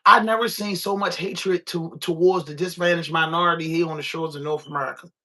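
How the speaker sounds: normal talking voice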